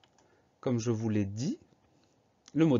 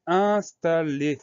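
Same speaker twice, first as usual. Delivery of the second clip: "installer" louder, drawn out in separate syllables; 7 semitones higher, 7 dB louder, much slower than usual. Shown as a demonstration. Teacher introducing the word installer to pupils, syllable by syllable. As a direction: break "installer" slowly into its syllables. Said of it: "deuxième syllabe".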